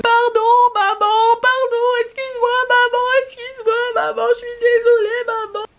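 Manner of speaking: put-on screaming child. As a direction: wail like a boy begging his mother. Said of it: "il commence à hurler".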